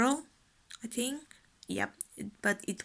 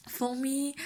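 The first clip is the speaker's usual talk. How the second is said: normal talking voice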